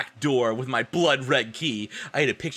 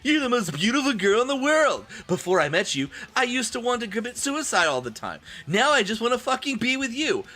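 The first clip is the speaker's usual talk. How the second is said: higher, gushing mock-romantic boy; hammed-up lovesick Draco; 9 semitones higher than usual. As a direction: overact the swooning confession. Voicing Draco's love speech.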